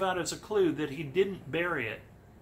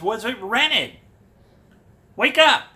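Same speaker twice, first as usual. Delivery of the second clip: loud, high exasperated shout; mock yell; 5 semitones higher, 12 dB louder than usual. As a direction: yell at him to wake up, hammed-up. Interviewer mock-yelling at Fenn.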